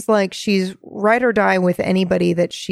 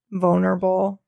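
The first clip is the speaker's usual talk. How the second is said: usual speaking voice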